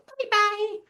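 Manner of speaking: very high squeaky little robot; tiny robot voice. Retold or imitated. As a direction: chirp it like a small robot. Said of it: "little ones go".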